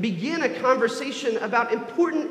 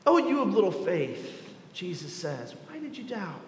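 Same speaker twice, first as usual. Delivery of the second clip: hushed, much softer gentle question; tender; 2 semitones lower, 5 dB quieter than usual. Acted. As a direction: let it fall to almost nothing, tenderly. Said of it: "and says tenderly".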